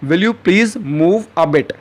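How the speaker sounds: very slow, each word spaced out for learners; slow teaching model. Shown as a demonstration. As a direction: say it slowly and clearly like a teacher modeling a phrase. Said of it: "तो English में आप क्या कहेंगे".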